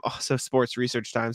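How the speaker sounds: ordinary talk, nothing marked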